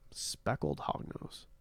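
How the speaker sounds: slow, low and under the breath; musing read-out. Real, not acted. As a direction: murmur it slowly to yourself. Reading out a puzzling chat question.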